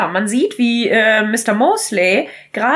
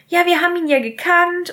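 timid maid; 8 semitones higher than usual. Retold or imitated.

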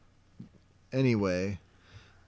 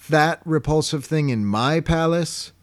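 high, loud mock indignation; scornful king; 7 semitones higher, 6 dB louder than usual. Imitating King Neptune sneering at Patrick, loud mock indignation. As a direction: play an appalled, haughty king. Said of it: "he looks at Patrick and says".

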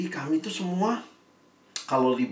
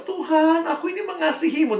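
much higher, earnest voice of Peter protesting his love; Peter declaring; 12 semitones higher than usual. Retold or imitated. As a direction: cry it out high like Peter protesting. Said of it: "Petrus jawab". (Petrus menyatakan kasihnya dengan suara sangat tinggi)

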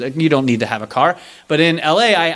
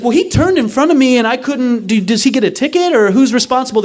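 indignant protest; 8 semitones higher, 4 dB louder than usual. Retold or imitated. Indignant question to cops, high.

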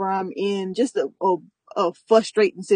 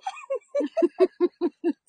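crowd laughter; 13 semitones higher, 4 dB quieter than usual. Real, not acted. Laughing at story.

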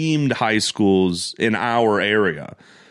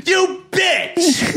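high, shouted "You bitch"; mock angry shout. Retelling the college shock, high mock shout. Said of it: "I was so pissed".

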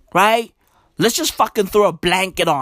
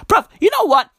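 high, louder exasperated burst; getting pissed; 12 semitones higher, 5 dB louder, faster than usual. Real, not acted. Vlogger losing patience with the task force, exasperated. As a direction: blurt it out fed up and rising. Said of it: "I'm getting pissed".

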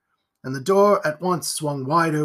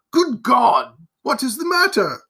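higher, startled man's outcry; alarmed exclamation; 7 semitones higher, 5 dB louder than usual. A narrator's reading. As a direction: burst out in alarm, pitch raised. Man alarmed by the screams.

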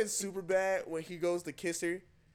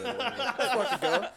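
loud, drawn-out party hype; hyped; 6 semitones higher, 5 dB louder, slower than usual. Retold or imitated. Teen storyteller hyping his first college party, hyped and drawn out. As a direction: stretch it out, higher and louder, half laughing.

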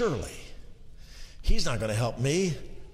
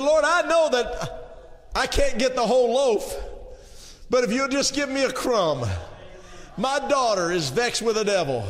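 pleading shout; 7 semitones higher than usual. Acted. Woman begs for a crumb.

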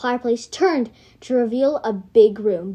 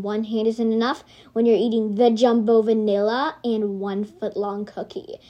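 usual speaking voice

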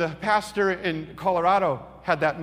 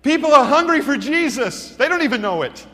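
loud, raised, emphatic proclamation; impassioned preaching; 7 semitones higher, 7 dB louder than usual. Real, not acted. Preacher driving home the point to congregation, impassioned. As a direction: proclaim it to the whole room.

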